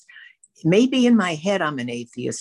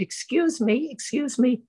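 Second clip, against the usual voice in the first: polite call; 9 semitones higher than usual. Retold or imitated. Calling to manicurist for attention.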